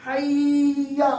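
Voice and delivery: حیا held very long and slow; wrong long vowel. Shown as a demonstration. Teacher repeating the wrong stretched call. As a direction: drag حیا out again to show the error. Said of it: "غلط کرتے ہیں".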